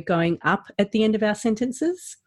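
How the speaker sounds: "sentences" lifted upward like a question; rising end tone. Shown as a demonstration. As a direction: let the tone climb through to "sentences". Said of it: "hopefully you can hear the difference".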